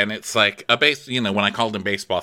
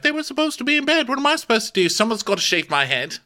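much higher, fast whiny excuse voice; mock-defensive knight, pitched way up; whiny Harold; 14 semitones higher, faster than usual. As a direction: squeal out the excuses, high and fast. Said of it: "And Harold's like".

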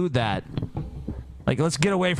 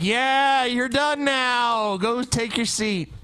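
slow, drawn-out brush-off, higher pitch; slow dismissal; 7 semitones higher, much slower than usual. Retold or imitated. Mocking the crowd sending the candidate back to his seat, slow and dismissive. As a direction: stretch it out like shooing someone off stage. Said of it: "everyone's like".

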